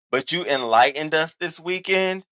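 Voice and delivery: ordinary delivery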